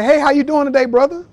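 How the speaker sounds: a mock-cheery hello to a gym rival; Jordan greeting. Michael Jordan greeting someone in the gym, mock-friendly and loud. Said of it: "he'd say".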